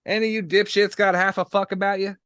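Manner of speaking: shouting it across the room; loud. Acted. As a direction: bark the question loudly. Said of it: "keep your voice down".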